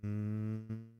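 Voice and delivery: ordinary talk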